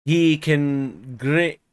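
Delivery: put-on slow, halting broad Yorkshire old servant; slow struggling rustic dialect reading; Joseph's thick dialect. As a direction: read the old servant's dialect, stumbling slowly.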